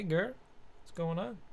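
ordinary talk

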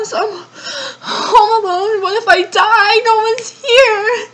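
crying in real fear of being alone; panicked crying. Real, not acted.

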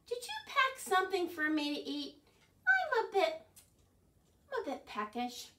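hungry Bird. Acted. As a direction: whine for a snack. Bird asks Fox for food.

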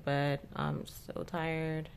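normal talking voice

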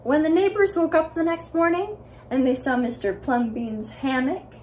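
usual speaking voice

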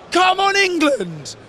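high, drawn-out cheering cry; imitated fan cheer. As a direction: re-enact urging on the team from the stands. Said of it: "nobody screams anything. I sit there and I go".

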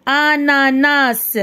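"Ananas" very slow, each syllable held. Shown as a demonstration. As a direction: draw "Ananas" out as a-na-nas. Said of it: "نقراو les syllabes". ("Ananas" مقطعًا مقطعًا ببطء شديد)

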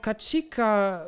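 high, slow, drawn-out call of Katschika; girl's voice impression. Streamer as the girl demonstrating the call, slow and high. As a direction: stretch Katschika out high and bright. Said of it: "dann ruf mal ganz laut".

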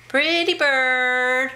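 slow singsong pet talk; talking to a parrot. Real, not acted. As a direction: stretch it out for a parrot to copy.

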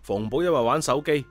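ordinary delivery

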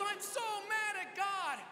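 high, slow, loud mock wail; tearful outburst. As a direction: imitate a woman wailing in a meltdown. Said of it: "just bursts out into tears".

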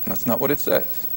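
a soft aside, that's not what it says; quiet flat correction. Acted. Preacher correcting a misquoted verse, quietly firm. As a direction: drop soft, firmly denying the misreading. Refuting wrong readings of verse.

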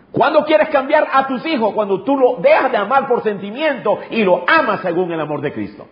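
high-pitched, driving sermon delivery; impassioned preaching. Real, not acted. Pastor urging parents about changing their children, impassioned preaching.

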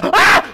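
high loud sudden yelp; startled scream. Real, not acted. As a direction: shriek in shock. Jump scare mid-sentence.

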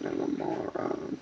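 whispered self-insult under breath. Real, not acted. Repeats self-insult, then whispers.

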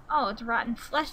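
usual speaking voice

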